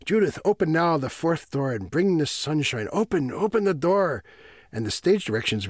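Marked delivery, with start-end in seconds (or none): 0.1-4.2 higher, urgent operatic command; Bluebeard. Acted. Bluebeard bidding Judith open the fourth door, insistent declaiming. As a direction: declaim it as an insistent plea, pitch climbing. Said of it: "Bluebeard says to Judith".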